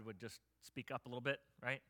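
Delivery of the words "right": ordinary talk, nothing marked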